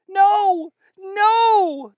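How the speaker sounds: very high, loud panicked cries; frantic. Acted. Feverish drummer boy refusing to rest, frantic high loud cries. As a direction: cry out in a panic. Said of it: "He sounded frantic".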